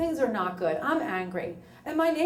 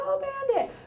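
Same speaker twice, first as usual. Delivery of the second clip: cooing at dog; faster than usual. Retold or imitated.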